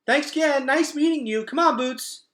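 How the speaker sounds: high, loud, hurried nervous politeness; nervous brush-off. Acted. Gregor nervously thanking Luxa while grabbing his sister to leave. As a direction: chirp goodbyes while already leaving.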